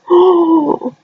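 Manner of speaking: a sharp, delighted gasp; excited gasp. Real, not acted. Excited reaction to gargoyles.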